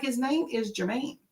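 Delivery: soft, gentle correction; nicer correction. Retold or imitated. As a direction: correct them politely without pushing. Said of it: "I would say it like that".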